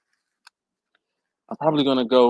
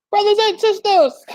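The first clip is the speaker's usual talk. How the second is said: high, louder preacher echo; mock preacher; 5 dB louder than usual. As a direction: ham up a revival call. Echoing viewer's greeting, preacher-like.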